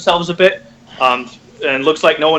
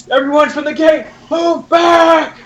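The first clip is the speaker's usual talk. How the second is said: much higher, loud warning shout; yelling order; 13 semitones higher, 4 dB louder than usual. Acted. Captain yelling at the men by the gate, loud shout. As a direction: yell the retreat order. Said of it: "you hear him start yelling".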